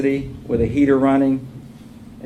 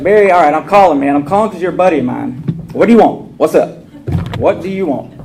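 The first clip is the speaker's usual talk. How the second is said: loud, higher, confrontational demand; aggressive call; 4 semitones higher, 7 dB louder than usual. Retold or imitated. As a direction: bark at the friend to get to the point. Angry phone call to friend.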